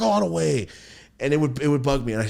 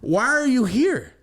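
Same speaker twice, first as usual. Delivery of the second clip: pitched way up and louder, drawn out; exaggerated baffled "Why are you here?"; high loud slow question; 14 semitones higher, 5 dB louder, slower than usual. To the grown man at grandma's, a high drawn-out "Why are you here?". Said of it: "And I said it to him like that".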